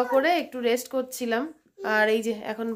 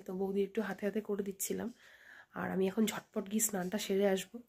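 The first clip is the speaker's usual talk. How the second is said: much softer, half-whispered vlog talk; whispered narration; 14 dB quieter than usual. Real, not acted. Baby sleeping, hushed talk. (ঘুমন্ত বাচ্চার পাশে ফিসফিস করে বলা)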